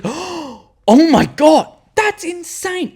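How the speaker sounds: gasp, then high-pitched mock awe; imitated amazement. Whole cinema reacts to the film, imitated amazement. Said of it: "everyone's like".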